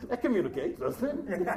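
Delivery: talking through real laughter; amused aside, laughing. Real, not acted. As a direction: chuckle through the words.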